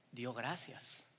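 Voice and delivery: "Dio gracias" low and hushed as the point; soft emphasis. Shown as a demonstration. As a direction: say "Dio gracias" quietly, weighing it. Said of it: "antes del milagro". ("Dio gracias" susurrado y solemne)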